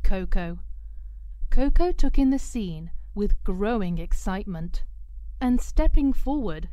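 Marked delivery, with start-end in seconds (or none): none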